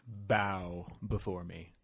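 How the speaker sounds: low, slow regal command; a king demanding his subjects bow; dark lord. Pretend. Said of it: "by our royal hosts".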